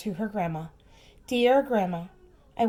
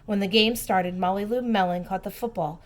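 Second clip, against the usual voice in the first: usual speaking voice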